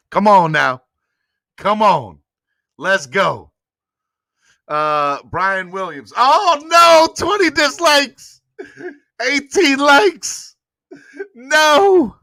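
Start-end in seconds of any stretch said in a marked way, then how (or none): 6.2-12.2 high, stretched, laughing dismay. Real, not acted. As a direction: react to the count in disbelief. Dismay at the dislike count.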